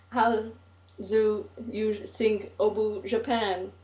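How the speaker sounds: a light mock Japanese-English accent, slowed; halting mock Japanese English. Narrator baiting Oi in a light mock Japanese English accent. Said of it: "mimicking the first English learned".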